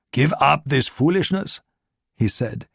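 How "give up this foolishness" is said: put-on light German accent, menacing; heavy German accent. A narrator's reading. Gestapo officer to Ricard in the lift, German accent. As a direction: lean into a German accent on French words. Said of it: "bearing a heavy German accent".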